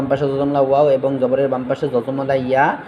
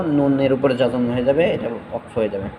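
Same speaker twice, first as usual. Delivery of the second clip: ordinary delivery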